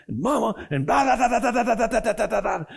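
high, mocking blah-blah fuss, laughing; mocking mother's fussing. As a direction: mimic a nagging mother with a laugh.

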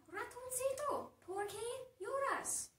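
lightly voiced mother, pitched high; concerned mother. Mother questions her crying child.

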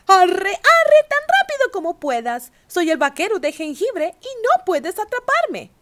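high, loud taunting sing-song; teasing cookie voice. A narrator's reading. Gingerbread cowboy taunting the ranchers, high loud sing-song. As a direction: taunt them, high and cocky. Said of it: "se rió y dijo".

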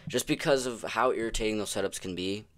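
ordinary delivery

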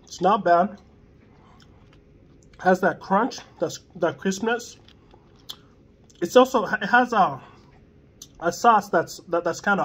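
speaking with food in mouth; muffled mid-bite speech; talking while chewing. Real, not acted. Seen 0: Holds burger, wipes mouth, lips working between words while speaking.

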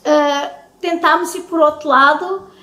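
usual speaking voice

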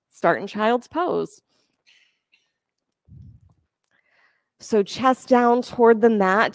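calm, steady instructor cues; yoga class cueing. Acted. Yoga teacher guiding students through poses, calm cueing. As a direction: lead the class through each pose gently.

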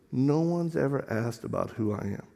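soft, low retold confession; imitated girl. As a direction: say it quietly, as the girl opening up. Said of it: "She goes".

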